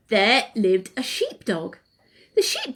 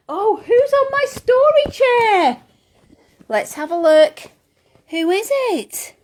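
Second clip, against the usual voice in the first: mock surprise; 9 semitones higher, 4 dB louder than usual.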